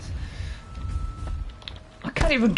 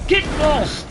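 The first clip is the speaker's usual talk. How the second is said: short, raised-pitch dismissal; angry shout; 5 semitones higher than usual. Real, not acted. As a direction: shout the enemy away. Player shouting at pursuer.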